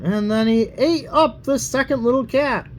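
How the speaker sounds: plain reading voice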